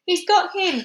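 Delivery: high, loud triumphant shout; excited cry. A narrator's reading. Monkey cheers the catch, high and loud. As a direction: yell it out in triumph. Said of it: "cried the monkey".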